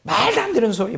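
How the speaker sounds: high-pitched indignant objection, mildly acted; imitating a disciple's vehement rebuke; vehement protest. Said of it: "막 격렬하게".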